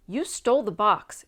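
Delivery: spring the accusation on him; sudden accusation. A narrator's reading. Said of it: "she accused him suddenly".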